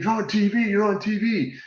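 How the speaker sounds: put-on high excited kid voice; a kid amazed at the TV, pitched high; excited son. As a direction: son calling out that dad is on TV. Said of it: "he's screaming".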